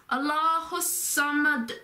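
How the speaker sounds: slow, intoned "Allahu Samad" with a held, heavy s; emphatic S sound. Shown as a demonstration. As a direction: lean on the s of "Samad". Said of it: "you put the emphasis on the".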